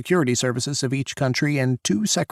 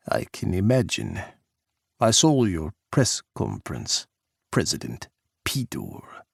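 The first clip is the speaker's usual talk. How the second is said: put-on lightly Russian-accented, lower and cool; a hint of a smug Russian leader; Russian president taunting Jack, Russian accent; 4 semitones lower than usual. Pukov's accented voice.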